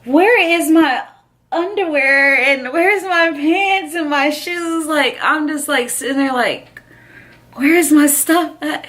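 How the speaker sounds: high, slow, bewildered asking; dazed alarm. Retold or imitated. Girl waking on the stretcher, bewildered about her clothes. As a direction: ask for your clothes, confused and slowed. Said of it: "I'm like".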